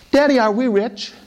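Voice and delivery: put-on high, sweet little-girl voice asking daddy. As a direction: voice a small daughter asking her father. Poor man's daughter asks father.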